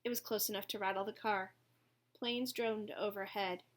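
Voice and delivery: ordinary delivery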